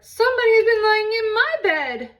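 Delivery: very high, loud near-shriek; Mama Bear's outraged cry. Acted. Mama Bear upstairs, crying out about her bed, very high and loud. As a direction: wail it in shocked outrage. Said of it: "cried Mama Bear".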